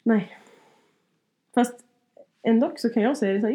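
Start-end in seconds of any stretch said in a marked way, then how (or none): none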